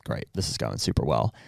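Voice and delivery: low, quiet deadpan; dry sarcasm. Retold or imitated. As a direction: mutter it with resigned irony. Sarcastic reaction to bad news.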